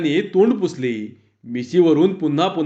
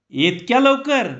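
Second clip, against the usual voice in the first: astonished; 7 semitones higher than usual. A narrator's reading. Villager amazed by camera speed.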